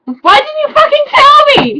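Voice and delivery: very high, loud theatrical yell; hammed-up shriek of betrayal; shouting. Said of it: "he shouted".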